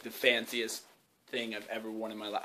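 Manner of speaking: ordinary talk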